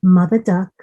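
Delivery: ordinary delivery, nothing marked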